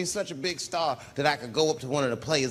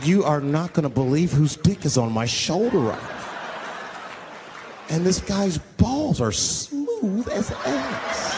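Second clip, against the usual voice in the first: excited brag; slower than usual. Pretend. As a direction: gush into the phone with a laugh. Celebrity brags on phone.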